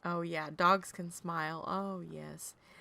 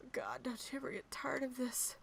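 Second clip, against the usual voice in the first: whispered, worn-out complaint; weary whisper; 5 semitones higher, 6 dB quieter than usual. Retold or imitated. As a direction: whisper it to yourself, fed up. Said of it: "I'm sitting there going".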